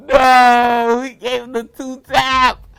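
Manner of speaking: gasp, then yell high and loud; shocked scream. Real, not acted. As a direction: scream in disbelief at the screen. Character shot on screen.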